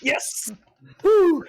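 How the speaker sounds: high-pitched, whooping celebration; excited cheer. Real, not acted. Player celebrating the game master's missed roll, excited cheer. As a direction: let out a jubilant, high cheer.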